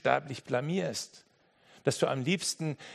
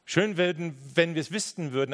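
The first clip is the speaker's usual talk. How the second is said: ordinary delivery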